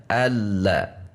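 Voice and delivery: "alla" with short final la, no push; lurus saja. Shown as a demonstration. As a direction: keep the la of "alla" short and unstressed. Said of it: "tekanan suara kita lurus saja". ("alla" lurus, la pendek tanpa tekanan)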